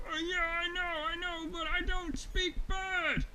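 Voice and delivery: high, protesting voice; helpless story bear. Acted. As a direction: call back down in a fluster. Stranded bear answers Honey Bear.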